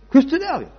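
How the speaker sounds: high, loud proclamation; emphatic answer. Real, not acted. Preacher answering his own question to the congregation, high and loud. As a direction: call out the name.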